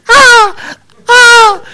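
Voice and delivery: high squealing cries of panic; imitating his wife's shrieks; mock screams. Pastor imitating his screaming wife. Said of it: "my wife's going".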